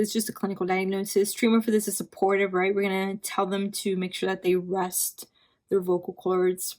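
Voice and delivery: ordinary delivery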